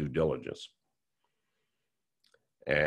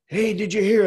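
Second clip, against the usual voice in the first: much higher and louder, bursting with the news; excited news; 8 dB louder than usual. Retold or imitated. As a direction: blurt it out like a reporter with a scoop. Reporter calls with the big news.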